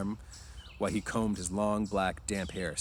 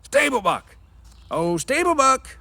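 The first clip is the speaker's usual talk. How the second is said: high, loud shout from outside; long drawn call for the stable buck; distant call; 12 dB louder, slower than usual. Acted. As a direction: call across the yard. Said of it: "A call came".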